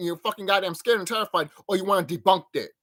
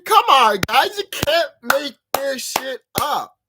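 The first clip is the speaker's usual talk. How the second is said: higher, louder, slow exclamation; excited outburst; 7 semitones higher, 7 dB louder, slower than usual. Real, not acted. Telling viewers the clowns are real, excited. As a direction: exclaim in amazement.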